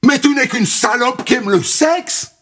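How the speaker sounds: high, shrill screeching bird woman; harpy screech. Acted. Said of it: "pousser des cris de harpie". (cris de harpie)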